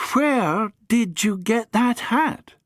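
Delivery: put-on high, slow and curious young woman; Amy puzzled by the battered hat; Amy's voice. Amy asks about the hat, high and slow.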